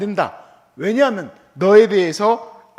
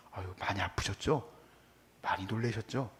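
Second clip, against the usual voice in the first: much softer, lower consoling voice; soft sympathy; 11 semitones lower, 15 dB quieter than usual. Retold or imitated. Pastor consoles the driver after a crash, soft and low. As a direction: gently console the other driver.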